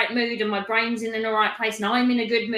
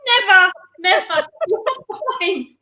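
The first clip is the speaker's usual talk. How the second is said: laughing reassurance; 7 semitones higher, 5 dB louder than usual. Real, not acted.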